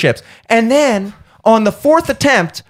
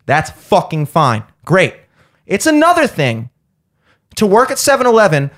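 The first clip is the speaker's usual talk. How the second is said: ordinary delivery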